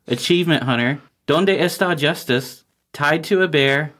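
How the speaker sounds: smooth, upbeat sponsor read; mock ad read. Host reads a joke sponsor spot for the listeners, polished and upbeat. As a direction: sell it like a podcast ad spot.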